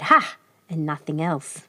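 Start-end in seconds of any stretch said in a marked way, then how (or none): 0.0-0.4 higher sharp wolf "Ha"; a greedy wolf's short reply; wolf's bark of a laugh. A narrator's reading. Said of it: "licked his lips and said".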